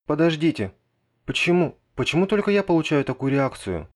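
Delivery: high, loud outraged whine; indignant protest. Acted. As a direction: protest the unfair treatment. (возмущённый протест)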